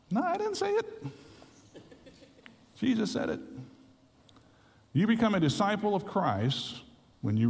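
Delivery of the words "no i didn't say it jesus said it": defensive. Real, not acted. Defends himself to heckler.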